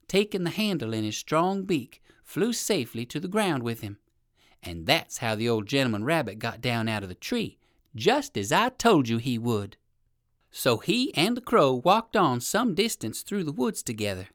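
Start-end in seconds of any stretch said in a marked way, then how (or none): none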